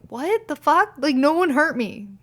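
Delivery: high, loud what the fuck; incredulous snap back; retold disbelief. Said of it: "I'm like".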